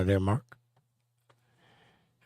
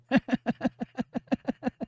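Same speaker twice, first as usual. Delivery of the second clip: high, soft run of giggles; long, quiet high-pitched chuckle; laughing; 12 semitones higher, 7 dB quieter than usual. Real, not acted. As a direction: giggle away helplessly. Amused after the pickle joke.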